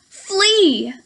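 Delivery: ordinary talk, nothing marked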